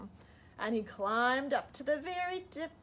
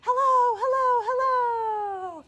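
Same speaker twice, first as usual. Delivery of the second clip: high, fading echoing hellos; a demo echo coming back from far off; 12 semitones higher, 9 dB louder, slower than usual. Shown as a demonstration. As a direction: let each hello fade away like an echo. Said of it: "you listen and you hear".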